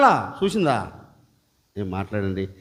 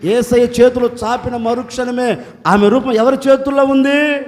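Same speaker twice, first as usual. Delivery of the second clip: ordinary delivery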